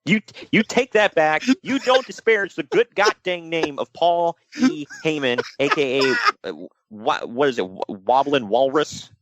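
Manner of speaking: mock outrage. Host theatrically defending the good name of Paul E., mock-indignant. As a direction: defend his honor with pompous mock offense.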